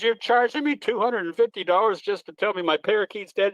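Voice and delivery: a hint of an outraged old lady; indignant pet owner.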